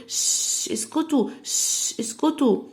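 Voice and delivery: ش whispered, then اسكتوا said high; play-acting a teacher shushing kids. Shown as a demonstration. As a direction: hush the noisy pair. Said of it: "اتنين صحاب بيقعدوا يعملوا صداع".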